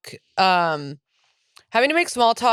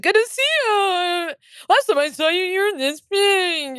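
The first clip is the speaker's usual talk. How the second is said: high, slow aunt voice; doting relative, high and drawn out; 10 semitones higher, slower than usual. Retold or imitated. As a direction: play the doting aunt at the holidays. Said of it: "And it's like".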